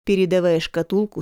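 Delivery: ordinary delivery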